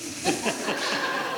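high airy giggle; ticklish giggle. Real, not acted. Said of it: "it tickles".

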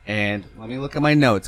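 ordinary talk

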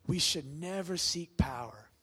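ordinary talk, nothing marked

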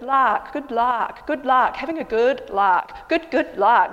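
lark said with a long open ah; luck like lark. Shown as a demonstration. Friend coaching luck to sound like lark. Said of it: "Say it like a lark, a bird".

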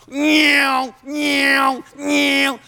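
high revving motor noise; imitated chainsaw whine. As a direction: whine like a chainsaw biting into wood.

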